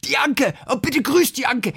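high, excited imitation of a fond friend; mock-effusive, high-voiced request; retold gushing plea. Retold fond plea to pass greetings.

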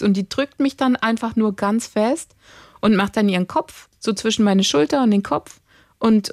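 usual speaking voice

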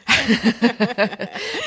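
quick chuckling laugh. Real, not acted. Asking about a devil's pact after the reading, laughing. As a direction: chuckle at your own question.

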